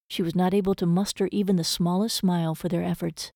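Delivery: plain reading voice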